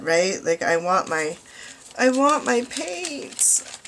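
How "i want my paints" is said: high, drawn-out playful whine; impatient mock whine. Crafter pining for her late paint order, mock whining. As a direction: whine it slowly like an impatient child. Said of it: "I'm waiting so impatiently".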